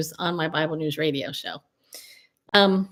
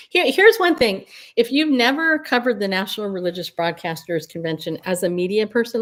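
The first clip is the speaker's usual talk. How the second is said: usual speaking voice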